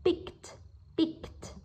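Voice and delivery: "picked" ending in a crisp T; final T sound. Shown as a demonstration. Teacher shows students the -ed ending, "picked" with final T. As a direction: release a voiceless T at the end of "picked". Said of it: "các bạn sẽ phát âm là âm T".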